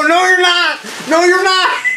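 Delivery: very high, strained scream of protest; retold screaming. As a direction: scream back at the medic in pain. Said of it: "Screaming at him".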